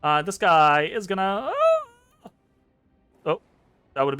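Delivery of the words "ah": very high drawn-out "ah"; high creature cry. Acted. Game master as rabbit attacking, long high cry. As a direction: squeal with the effort of a throw. Acting out rabbit throwing cleaver.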